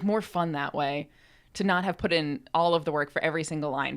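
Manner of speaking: ordinary delivery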